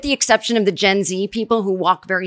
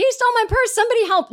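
mock scream for help; faster than usual. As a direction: imitate her calling for help, pitched way up. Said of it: "She's screaming".

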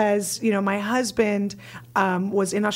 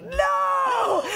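high, shouted no of disbelief; shocked no; 14 semitones higher than usual. Retold or imitated. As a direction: cry out a shocked no. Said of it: "And Mike is like".

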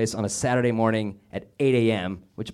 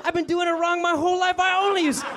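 much higher mock shout of dismay; mock outrage. Comic reacting to the instructor's rule, high mock outrage. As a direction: squeal it like a scandalized kid.

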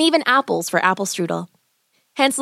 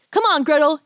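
yelling; 7 semitones higher than usual. A narrator's reading. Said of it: "Hansel yelled".

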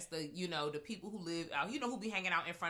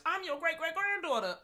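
high mock character voice; 11 semitones higher, 6 dB louder than usual. Quoting the movie character.

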